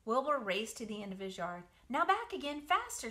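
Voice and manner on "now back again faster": higher urging call. Acted. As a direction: push him to go faster. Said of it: "said Charlotte".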